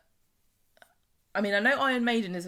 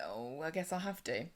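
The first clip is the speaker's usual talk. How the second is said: acted reluctance; 4 semitones lower, 10 dB quieter than usual. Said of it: "Emma's like".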